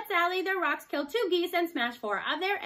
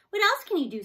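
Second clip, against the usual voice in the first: higher, louder eager question; can't wait to see more; excited question; 9 semitones higher, 7 dB louder than usual. A narrator's reading. Boy asking the robot for more tricks, eager and loud.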